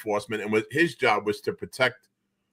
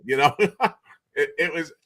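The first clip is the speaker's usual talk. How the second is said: usual speaking voice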